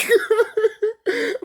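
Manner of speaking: loud high-pitched cackle; high laugh. Real, not acted. Laughing at the memory of the scene, high cackle. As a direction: burst out in a shrill laugh.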